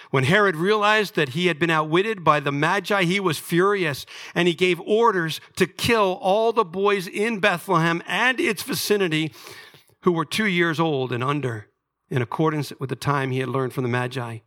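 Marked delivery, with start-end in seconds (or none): 0.1-11.7 higher, intense preacher's reading; forceful scripture reading. A narrator's reading. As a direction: read the verse out with building force.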